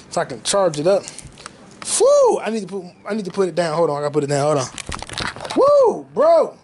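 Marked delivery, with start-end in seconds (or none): 2.0-2.4 high-pitched excited "whew"; amped whoop. Real, not acted. Fan amped for new movies, high excited whoop. 5.6-6.1 high, excited "woo"; pumped-up whoop. Real, not acted. Fan pumped for movies, high whoop.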